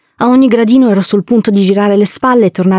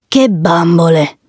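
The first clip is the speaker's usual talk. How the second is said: domanda lenta da orco di quartiere; Don Achille burbero; slower than usual. A narrator's reading. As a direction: chiedi lentamente, da uomo burbero. Don Achille sbrigativo con le bambine. (uomo burbero, lento)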